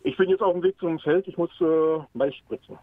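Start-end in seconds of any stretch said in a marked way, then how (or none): none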